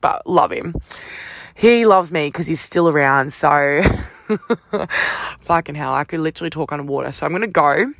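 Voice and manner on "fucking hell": ordinary talk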